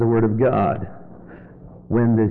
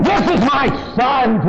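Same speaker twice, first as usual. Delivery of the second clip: proud, raised declaration of This is my son; proud announcement; 11 semitones higher than usual. Shown as a demonstration. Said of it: "I changed the tone of my voice from".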